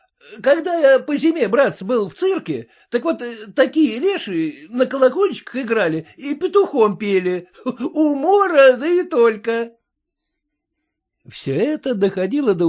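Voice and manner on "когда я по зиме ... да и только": смеясь. A narrator's reading. Разносчик смеётся.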